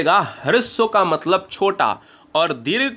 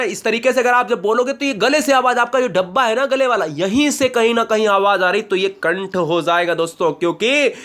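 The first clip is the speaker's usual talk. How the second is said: ordinary talk, nothing marked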